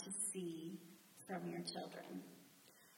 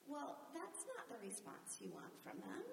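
ordinary talk, nothing marked

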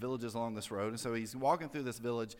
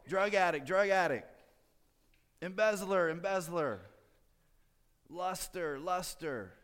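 higher-pitched pretend calling-out, each label repeated; mock yell; 5 semitones higher, slower than usual. Preacher imagines churchgoers yelling out their issues, mock yell. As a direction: call it out like a confession to passers-by. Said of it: "What if we had to yell".

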